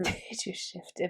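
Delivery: mock it slowly and quietly; unimpressed slow whisper of "Did you shift him?"; slow deadpan "Did you shift him?". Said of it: "That sounds not romantic at all".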